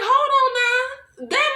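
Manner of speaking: high, drawn-out mock protest; mock objection. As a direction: stretch it out high, halting everyone. Acts out own objection to the plea.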